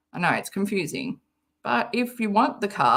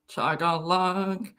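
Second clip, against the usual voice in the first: Chug-a-lug dragged out slowly and softer; slow, mock-serious Chug-a-lug; 2 semitones lower, 6 dB quieter, slower than usual. Shown as a demonstration. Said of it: "repeats the phrase slowly, lower".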